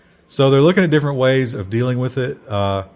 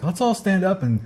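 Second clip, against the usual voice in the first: higher-pitched mocking imitation of a facilitator; mock group icebreaker; 8 semitones higher than usual. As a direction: parody a silly college icebreaker prompt. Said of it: "that stupid thing where it's like".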